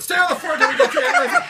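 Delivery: retold shout. Dad telling kids in the car to stay down, mock shout. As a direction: bark it over the back seat, half laughing.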